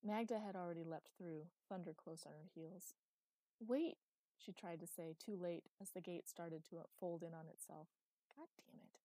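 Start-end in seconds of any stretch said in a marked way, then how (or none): 3.6-4.0 sharp call, high and loud. Acted. Calling after companions. 8.4-9.0 unvoiced curse under breath; whispered curse. Real, not acted. Narrator's muttered aside.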